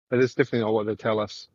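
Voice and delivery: usual speaking voice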